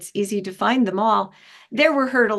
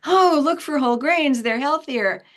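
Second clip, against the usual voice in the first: soapbox preaching; 7 semitones higher, 3 dB louder than usual. Retold or imitated. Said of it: "get on your soapbox".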